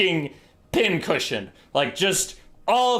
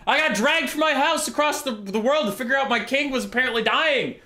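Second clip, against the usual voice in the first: much higher, frantic complaint; exasperated high rant; 11 semitones higher than usual. Acted. Broke general pleads with Nordlanders, high and frantic. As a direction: wail that you have nothing at all.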